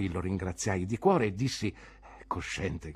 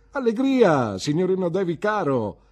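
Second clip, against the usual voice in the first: bright, loud, higher greeting; cheerful exclamation; 9 semitones higher, 8 dB louder than usual. A narrator's reading. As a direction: burst out with simple joy. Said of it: "esclamò Cam col suo fare semplice". (esclamazione allegra e più alta)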